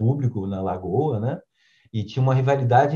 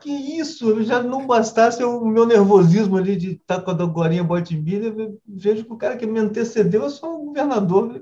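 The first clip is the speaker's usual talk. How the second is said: shocked disbelief; 11 semitones higher than usual. Retold or imitated. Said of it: "eu digo".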